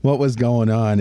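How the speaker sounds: ordinary talk, nothing marked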